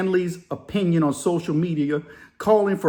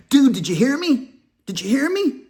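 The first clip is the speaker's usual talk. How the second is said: put-on New Jersey accent; 8 semitones higher than usual. Redoing the line to sound New Jersey, mock Jersey accent. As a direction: redo it as a Jersey guy, "Dude" up front. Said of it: "I need to pull it back".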